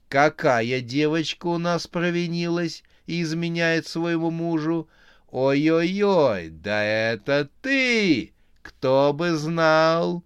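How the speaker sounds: high, loud, drawn-out clown mockery; taunting evil clown. A narrator's reading. Chainsaw clown taunting the cheating girl, high and loud. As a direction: gloat like a deranged clown.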